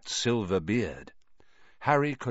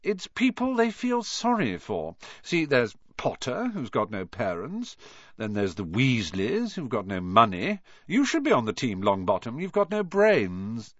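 mock them one by one; mocking sneer; 2 semitones higher than usual. Malfoy mocks the Gryffindors.

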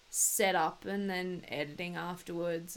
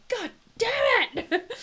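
a high, loud, slowed yell breaking into a laugh; frustrated outburst; 9 dB louder, slower than usual. Retold or imitated. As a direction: blurt it out at your own mistake. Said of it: "I'm like".

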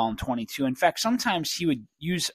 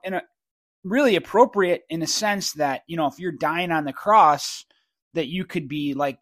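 ordinary talk, nothing marked

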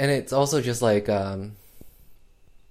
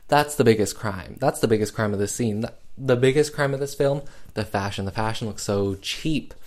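ordinary talk

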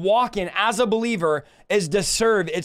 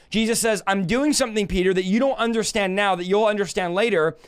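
ordinary talk, nothing marked

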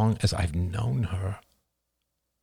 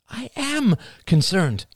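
protesting mother; 14 semitones higher, slower than usual. Acted.

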